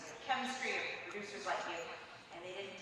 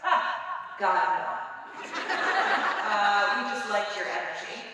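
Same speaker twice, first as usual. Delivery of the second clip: louder, amused take on the boss brushing it off; loud boss reply; 11 dB louder, slower than usual. Retold or imitated. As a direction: boom it out like the boss, laughing. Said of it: "I remember he goes".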